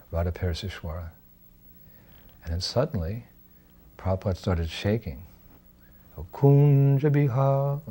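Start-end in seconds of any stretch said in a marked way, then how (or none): 6.2-7.8 slow, higher, drawn-out cry of longing; ecstatic cry. Retold or imitated. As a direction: stretch out the name, overcome with devotion. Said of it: "like this".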